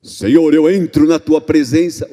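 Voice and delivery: loud, declamatory mock prayer voice; changed prayer intonation. Daughter addressing parent as if praying, mock declamatory. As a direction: put on a booming, formal praying tone. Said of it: "mudasse o tom totalmente".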